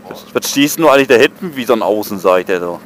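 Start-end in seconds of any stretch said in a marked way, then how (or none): none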